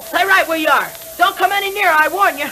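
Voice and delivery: high, strained shout; desperate warning. Acted. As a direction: hold them back with terror. Kid warns men to stay back.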